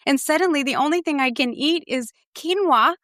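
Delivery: high, laughing, exaggerated complaint; mock despair. Real, not acted. Jokes to co-host about eating only quinoa and beets, high and laughing. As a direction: ham up the misery of a tiny menu. Joking about restrictive food rules.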